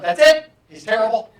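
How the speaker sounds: loud, laughing mock cry of the student; a hammed-up teen declaring he's terrible; mock outburst. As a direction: blurt it out with a laugh as the fed-up girl. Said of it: "She was like".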